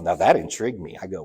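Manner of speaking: ordinary talk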